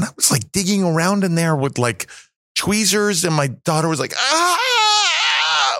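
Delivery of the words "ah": high mock shriek of a scared child; imitated panicked scream. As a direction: shriek like the daughter did at the tweezers. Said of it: "freaking out".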